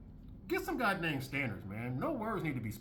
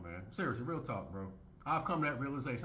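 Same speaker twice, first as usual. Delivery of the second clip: ordinary talk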